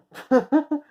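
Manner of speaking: sudden high-pitched laugh. Real, not acted. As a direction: burst out in a high giggle. Laughing at own joke.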